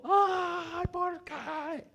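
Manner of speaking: high, hammed-up wail of grief; mock wailing cry. Esau wailing over the lost blessing, hammed-up and high. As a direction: cry out like an overdone mourner.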